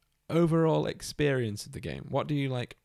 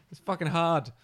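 usual speaking voice

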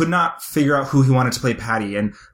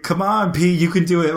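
hyping up; 5 semitones higher than usual. Retold or imitated. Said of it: "And he's like".

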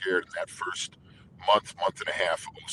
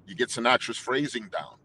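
normal talking voice

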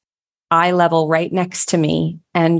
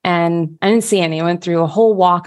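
usual speaking voice

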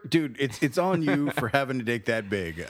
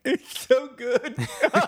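gleeful crocodile; 13 semitones higher, 5 dB louder, slower than usual. Retold or imitated. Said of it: "that reptile might have been like".

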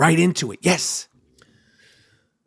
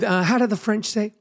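normal talking voice

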